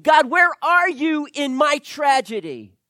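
loud, high, pleading outcry; anguished cry to God. Retold or imitated. Voicing a hurt believer's cry.

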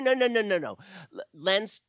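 ordinary delivery

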